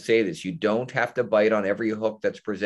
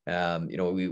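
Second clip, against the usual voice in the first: ordinary talk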